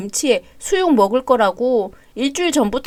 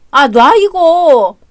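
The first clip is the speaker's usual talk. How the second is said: annoyed groan; 2 semitones higher, 4 dB louder, much slower than usual. Acted. Husband annoyed at sick wife.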